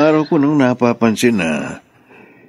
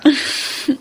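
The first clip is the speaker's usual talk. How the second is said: light laugh. Acted. Husband jokes fondly.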